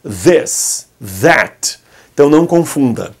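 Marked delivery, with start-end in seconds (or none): 0.0-1.8 tongue between teeth on This, that; English th demo. Shown as a demonstration. Teacher models the English th sound. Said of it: "H em inglês de brother".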